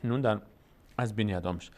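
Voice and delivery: usual speaking voice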